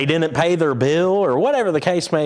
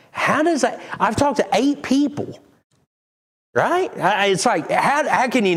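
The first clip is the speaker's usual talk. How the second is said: exasperated outburst; 10 semitones higher than usual. Real, not acted. Complaining about the internet company, exasperated and much higher. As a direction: voice jumps much higher in disbelief. Exasperated retelling of phone calls.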